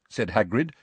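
usual speaking voice

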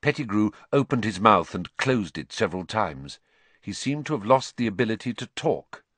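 ordinary delivery, nothing marked

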